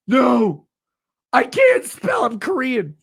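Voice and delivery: high mock yell of a silly excuse; mocking shouted fan excuse. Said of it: "Literally just".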